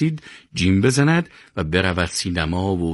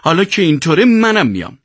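high-pitched, offended protest; hurt, indignant whine; sulky, aggrieved; 10 semitones higher, 4 dB louder than usual. A narrator's reading. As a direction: complain about being left out. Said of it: "سیاوش با دلخوری گفت".

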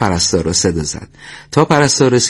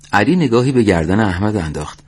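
plain reading voice

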